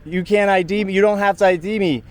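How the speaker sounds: normal talking voice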